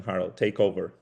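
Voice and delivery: ordinary talk, nothing marked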